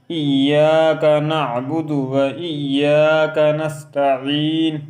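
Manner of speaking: slow, melodic, drawn-out tilawat; Quranic recitation. Shown as a demonstration. Teacher reciting Fatiha as in prayer, slow melodic chant. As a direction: chant it slowly with long vowels.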